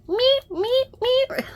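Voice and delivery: tiny high squeaky kitten meeps. Retold or imitated. Imitating the kitten in the box, high squeaky meeps.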